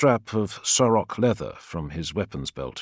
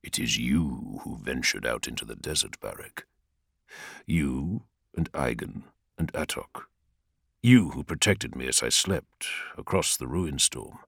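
lower, soft tender father; reassuring Primarch; 5 semitones lower, 4 dB quieter than usual. Acted. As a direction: reassure him gently. Vulcan reassures.

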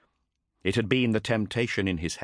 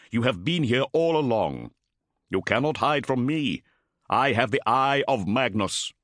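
raised, ringing challenge; commanding warrior voice; 6 semitones higher, 3 dB louder than usual. Acted. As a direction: challenge the hidden enemy. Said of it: "cried Motep".

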